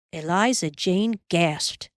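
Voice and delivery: plain reading voice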